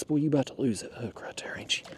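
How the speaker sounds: whispering to the fish. Real, not acted. Talking softly to the hooked fish.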